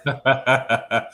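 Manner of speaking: short burst of laughter. Real, not acted. Co-host put on the spot to answer first, laughing. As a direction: laugh out loud in a quick run.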